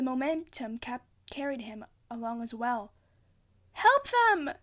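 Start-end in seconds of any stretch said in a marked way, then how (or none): 3.7-4.5 loud desperate yell, high. A narrator's reading. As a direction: shout for help at the top of the voice. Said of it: "Hazel yelled".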